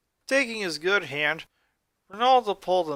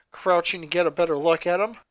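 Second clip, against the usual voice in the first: ordinary delivery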